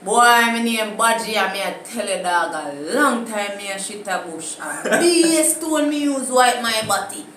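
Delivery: loud, brash patois brag, hammed up; swaggering Jamaican patois; Jamaican patois boast. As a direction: play a braggart in thick patois. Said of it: "My turn".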